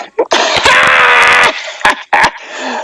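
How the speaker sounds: a big high burst of laughter. Real, not acted. Host bursting out laughing at a news story.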